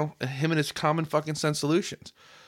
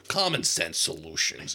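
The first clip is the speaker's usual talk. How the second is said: mock stern politician; 9 semitones lower than usual. Mocking Hogan's stance.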